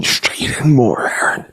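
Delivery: pained breathy whisper. Real, not acted. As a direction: whisper it, barely able to speak. Pained from hot pepper.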